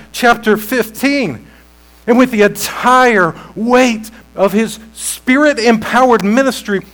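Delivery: building preacher's cadence to a ringing climax; full-force preaching. Real, not acted. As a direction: proclaim each title with mounting power. Preaches the I am sayings.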